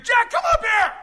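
high, loud call from on high; summoning shout. Acted. Preacher imagines his call at the rapture, loud summons. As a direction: shout him up by name. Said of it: "I'm gonna hear".

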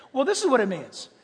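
ordinary delivery

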